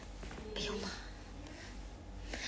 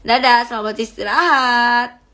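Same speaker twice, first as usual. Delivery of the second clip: usual speaking voice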